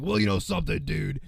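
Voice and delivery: a hint of Hogan; Hulk Hogan impression. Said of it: "It sounds more like Hogan now".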